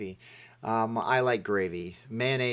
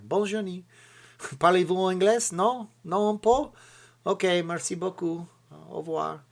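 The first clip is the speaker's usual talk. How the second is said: mock French; 8 semitones higher than usual. Pretend French chat with a stranger.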